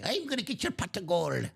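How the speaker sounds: a lightly voiced little leprechaun; playful hint of a leprechaun for a child. Dad doing a light leprechaun voice for his daughter. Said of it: "my little leprechaun voice".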